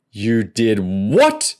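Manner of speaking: slow, drawn-out outraged shout; a fat crook yelling at the kids who burned his car. A narrator's reading. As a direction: stretch each word out in disbelief. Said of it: "Crazy Joe shouted".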